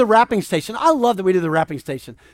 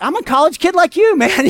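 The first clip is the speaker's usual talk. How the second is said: high, louder retold objection; mock protest; 7 semitones higher, 5 dB louder than usual. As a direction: push back at a buddy, pitched way up. Retold protest to his buddy.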